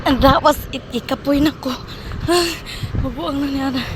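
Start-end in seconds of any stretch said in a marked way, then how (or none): 0.1-1.7 tired outburst. Real, not acted. Exhausted after the run. 1.8-3.0 long voiceless exhausted sigh; heavy sigh. Real, not acted. Tired runner sighing to the camera.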